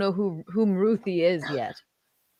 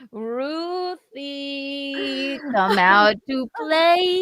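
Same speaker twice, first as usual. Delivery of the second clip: creepy sing-song call; 8 semitones higher, 3 dB louder, much slower than usual. Pretend. Calling Ruthie in creepy voice.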